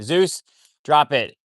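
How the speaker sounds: slow, loud, with the weight on Zeus; slow drawn-out command. Real, not acted. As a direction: drag the order out so the dog gets it. Repeats the order to the dog.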